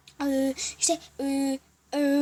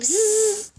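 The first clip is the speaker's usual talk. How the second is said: buzzing noise; 9 semitones higher, 5 dB louder than usual. Shown as a demonstration. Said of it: "Kendim yapıyorum".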